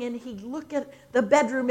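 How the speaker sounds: ordinary delivery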